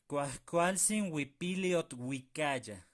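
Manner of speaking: "Cuajcualzin huipiliot huicaya" despacio, bien articulado; lento y claro. Shown as a demonstration. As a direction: exagera cada sonido, sin prisa. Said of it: "Vamos a ver". (verso lento y marcado)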